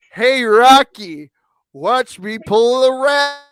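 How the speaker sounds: slow, loud moose voice; booming cartoon moose. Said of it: "the moose said to the squirrel".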